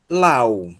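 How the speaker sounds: "Lau" said as one stressed diphthong, a then u; model pronunciation. Shown as a demonstration. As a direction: model "Lau" clearly for the class to repeat. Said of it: "essa é a sílaba tônica da palavra". (pronúncia modelo de "Lau")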